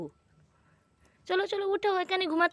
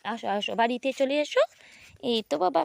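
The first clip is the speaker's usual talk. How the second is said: normal talking voice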